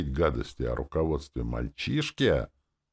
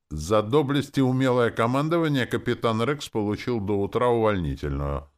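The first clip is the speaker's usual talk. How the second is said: loud, high stern announcement; strict, emphatic pronouncement; 8 semitones higher, 5 dB louder than usual. A narrator's reading. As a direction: state it firmly and officially. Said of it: "сказал я строго".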